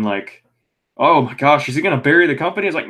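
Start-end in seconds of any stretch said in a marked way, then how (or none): 1.0-2.7 mock shock, pitched up and louder; alarmed question. Wrestling fans fretting over an induction, mock alarm. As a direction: imitate worried fans with a rising question. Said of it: "people were thinking".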